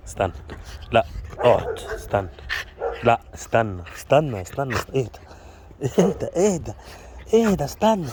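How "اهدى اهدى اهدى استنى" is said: high, soothing calm-down; high pet-soothing voice; soothing the dog. Real, not acted. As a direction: settle the excited dog in a high, coaxing voice. Calming an excited dog.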